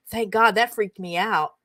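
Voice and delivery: normal talking voice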